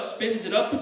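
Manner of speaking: plain reading voice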